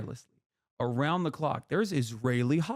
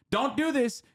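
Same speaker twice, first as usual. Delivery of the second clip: much higher, a mocking sing-song warning; mocking high quote; 11 semitones higher than usual. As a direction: parody a toothless scolding. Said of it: "'Cause America says".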